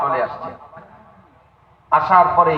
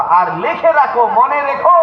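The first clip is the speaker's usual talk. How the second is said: "লিখে রাখো" pitched high, climbing to "মনে রেখো"; high, insistent repeat of the order; emphatic command; 11 semitones higher, 5 dB louder than usual. Acted.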